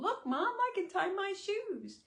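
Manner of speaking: put-on high, loud little child's voice; proud three-year-old. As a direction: brag to Mom like a proud toddler. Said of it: "it's like a three-year-old".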